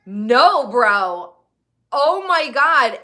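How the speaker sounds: loud, slowed oh my God; can't believe this, say it big; exasperated. Real, not acted. Exasperated disbelief.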